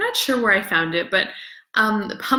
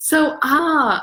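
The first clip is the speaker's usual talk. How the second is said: normal talking voice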